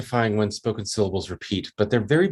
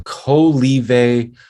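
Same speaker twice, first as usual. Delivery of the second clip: slow stimulus syllables; 3 semitones higher, much slower than usual. Shown as a demonstration. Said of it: "And the participant hears".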